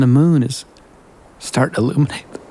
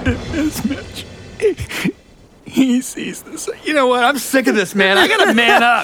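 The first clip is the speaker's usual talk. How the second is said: crying; 9 semitones higher than usual. Acted. Brad moved to tears thinking of Mitch, tearful. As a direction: choke it out, overcome by the sunset and Mitch. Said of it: "made you cry".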